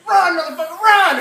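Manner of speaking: shouted high and loud, urgent; retold yell. Friend yelling to flee the lit-up house, loud urgent shout. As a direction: yell at him to run for it.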